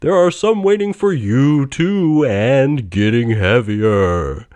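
loud, high, slow spectral voice; booming ghost. A narrator's reading. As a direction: boom it out like a phantom. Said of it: "said the ghost".

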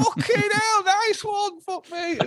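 very high falsetto squeal; mock amazement. Musician greeting the band's unexpected return, squealing amazement. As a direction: act out overjoyed disbelief in a comic squeak. Retold amazed reaction to comeback.

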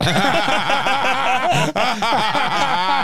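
loud, high burst of laughter; big real laugh. Real, not acted. Storyteller cracking up at the punchline, loud laughter.

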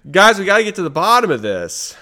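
high, loud call to the listeners; rally the troops, all fired up; excited. Real, not acted. Cohost sold on conspiracy, calls listeners excitedly.

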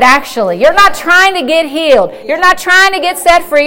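ordinary talk, nothing marked

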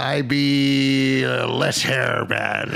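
pirate voice. Pretend. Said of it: "What be your pirate name".

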